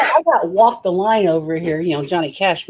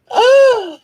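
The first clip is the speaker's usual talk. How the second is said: sharp high gasp of shock; 6 dB louder than usual. Real, not acted. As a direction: gasp as if scandalized.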